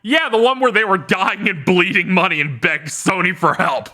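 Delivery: high, incredulous comeback; hammed-up scornful rebuttal; mock exasperated retort. Acted retort to imagined complainer.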